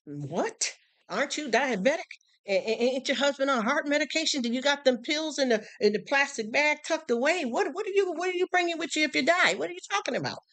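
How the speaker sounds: high, rising disbelief at their priorities; exasperated high-pitched questioning of the neighbors; incredulous. Retold or imitated. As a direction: ask it like you can't believe what you're hearing.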